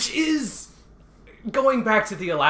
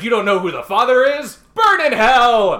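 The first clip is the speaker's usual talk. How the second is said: hammed-up damning cry; mock outrage; 6 semitones higher, 8 dB louder than usual. Mocking coworkers' outraged condemnation.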